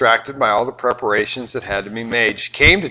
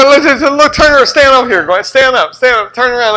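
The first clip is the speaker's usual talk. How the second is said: laughing mock orders; 12 semitones higher, 9 dB louder than usual.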